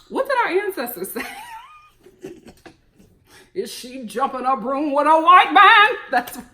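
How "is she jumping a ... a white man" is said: sung, loud Harriet Tubman voice. Retold or imitated. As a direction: sing it like Harriet Tubman scolding. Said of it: "that's my Harriet Tubman impersonation".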